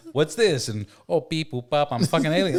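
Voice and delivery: loud, high robotic alien bleeps; silly alien voice. Retold or imitated. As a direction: ham up a goofy alien. Friends' game, alien voice.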